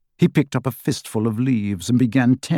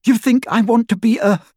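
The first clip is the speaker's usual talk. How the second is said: sneering sister; 11 semitones higher, 4 dB louder than usual. A narrator's reading.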